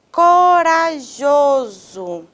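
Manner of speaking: CORAJOSO slowly stretched, co-ra-JO-so; stressed middle syllable. Shown as a demonstration. Teacher quizzing students on stress, slow CORAJOSO with long JO. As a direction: draw out CORAJOSO, leaning hard on JO. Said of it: "O jô".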